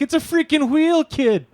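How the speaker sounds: high, excited Boston fisherman; Boston accent. Retold or imitated. Fisherman in a viral video spots a sunfish, excited Boston accent. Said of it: "calibrate the Boston accent".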